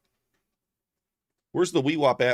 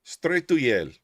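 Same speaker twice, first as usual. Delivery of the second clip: higher, louder mock Hungarian-ish accent; mock foreign accent; 6 semitones higher, 4 dB louder than usual. Streamer repeating the TikTok guy's catchphrase in a mock accent. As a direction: blurt it in the put-on accent. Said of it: "Must be Hungarian".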